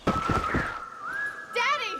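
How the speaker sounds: high, frightened call; alarmed cry. Acted. Daughter crying out on finding her wounded father. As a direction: cry out for your hurt father.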